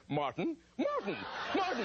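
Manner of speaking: thin, far-off calling from a handset; tiny voice from phone. Retold or imitated. Tony still on the line, calling from the phone, thin and far off. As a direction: call his name out of a dropped phone.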